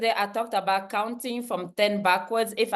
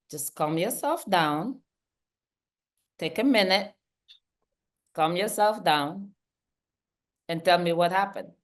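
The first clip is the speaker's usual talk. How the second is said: calm; 4 semitones lower than usual. Retold or imitated. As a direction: soothe the upset coworker gently. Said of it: "I would respond very calmly".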